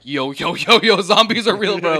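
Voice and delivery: high, loud mock panic; freaked out. Gang members warning the police, hammed-up panic. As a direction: blurt it out in rising alarm. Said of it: "these dudes are freaked the fuck out".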